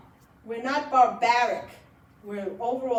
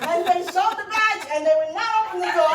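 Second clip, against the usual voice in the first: high and loud, talking through laughter; can barely get it out for laughing; 7 semitones higher, 8 dB louder than usual. Real, not acted. Telling the audience the kids' story, laughing hard.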